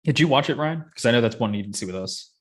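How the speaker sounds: normal talking voice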